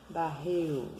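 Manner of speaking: "Barril" ending like "barriu". Shown as a demonstration. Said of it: "com som de U também". ("Barril" com L com som de U)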